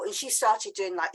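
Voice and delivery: usual speaking voice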